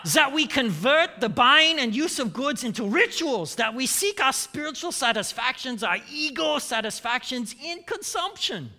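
emphatic fake German accent. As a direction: read it out as a mock German economist. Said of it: "in a fake German accent".

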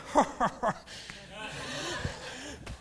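put-on raspy laugh trailing away; raspy chuckle. Mentor amused by his own excuse, raspy laugh.

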